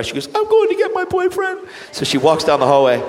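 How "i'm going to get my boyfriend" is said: very high, loud mock-tearful squeal; put-on high weepy voice; imitating crying. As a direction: mimic a woman wailing through tears. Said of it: "She started to cry".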